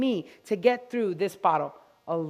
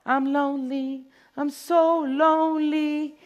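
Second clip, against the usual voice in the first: whiny, drawn-out plea of a lonely bottle; lonely shampoo voice; 4 semitones higher, slower than usual. Acted. Groomer voicing a lonely shampoo bottle, pleading. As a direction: beg to be picked, stretching the words.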